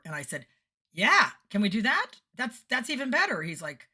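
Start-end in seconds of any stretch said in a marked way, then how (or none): 0.9-3.4 high, loud, eager burst; delighted acceptance. Retold or imitated. Singer accepting the tech's better mics, loudly delighted. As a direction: jump at the offer with glee.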